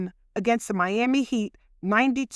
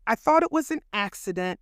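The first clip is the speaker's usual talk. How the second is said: normal talking voice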